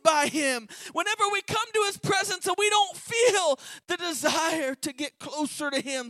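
climbing, loud, pleading sermon; fervent preacher crying out; preaching. Acted. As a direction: press it on the congregation's hearts. Preaching at full force.

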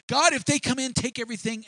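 rising to a loud, high preaching cry; building sermon cry. Real, not acted. Preacher to his congregation, building to a high shout. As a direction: preach it harder line by line, up to a shout.

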